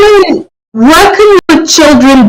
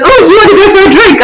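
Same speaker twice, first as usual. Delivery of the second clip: high, fast, excited invitation; a hammed-up party invite; mock friend inviting; 11 semitones higher, much faster than usual. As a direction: sing it out like an eager friend. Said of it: "someone calls me up and goes".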